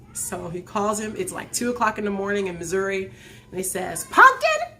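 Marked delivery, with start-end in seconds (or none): 4.1-4.8 high, loud excited call; excited, rising shout down the phone. Retold or imitated. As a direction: call out excitedly to wake someone. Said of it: "Elder Randolph's excited".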